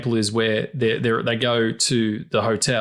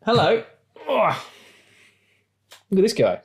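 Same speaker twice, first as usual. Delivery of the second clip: cooing at cat; 10 semitones higher than usual. Real, not acted. Talking to the cat.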